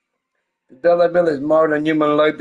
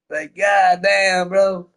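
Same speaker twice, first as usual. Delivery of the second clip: drawn-out exclamation; 7 semitones higher, much slower than usual. Real, not acted. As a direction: stretch it out in mock disbelief.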